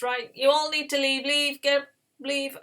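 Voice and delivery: acted urgent command; urgent dismissal. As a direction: order everyone out of the room. Joseph orders his servants out.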